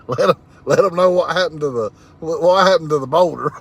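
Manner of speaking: laughing. Real, not acted. Teasing chat about the Boulder.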